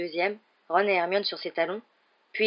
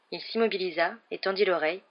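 ordinary delivery

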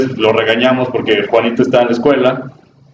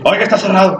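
mock-angry, pitched high; angry scolding; 7 semitones higher, faster than usual. Retold museum lady yelling at him: high mock-angry scolding. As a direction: bark it like a rude doorkeeper, imitated. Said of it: "pero así como realmente enojado".

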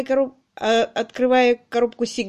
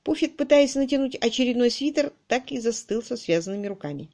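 ordinary delivery

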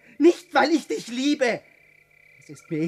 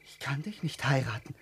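low muttered refusal; 11 semitones lower, 11 dB quieter than usual. Acted. Refusing marriage, repeated twice.

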